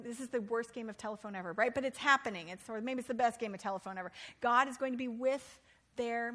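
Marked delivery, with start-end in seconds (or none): none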